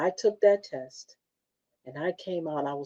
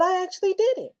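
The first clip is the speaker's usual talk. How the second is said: proud self-praise; 13 semitones higher, faster than usual. Shown as a demonstration. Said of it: "I need to say".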